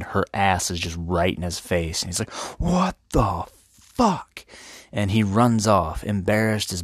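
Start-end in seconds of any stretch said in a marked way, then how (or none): 2.6-4.5 very high, slow comic disbelief; drawn-out mock horror. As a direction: stretch every word in hammed-up shock. Acted shock on opening his eyes.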